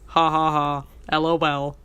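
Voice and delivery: low, spoken-out fake laugh; mock chuckle. Podcaster imitating Burke's odd chuckle, mock laughing in a low voice. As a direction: lightly imitate a chuckle, word by word.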